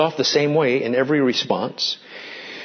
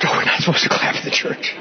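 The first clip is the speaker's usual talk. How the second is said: a whispered aside in mock embarrassment; faster than usual. Pastor mimicking a congregant, hushed whisper. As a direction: hush it like a scolded churchgoer. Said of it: "people start to clap at this church".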